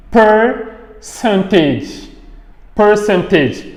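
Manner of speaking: stretched "per" without an R in "percentage"; silent R. Shown as a demonstration. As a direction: slowly model "percentage", dropping the R. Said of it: "on ne lit pas le R".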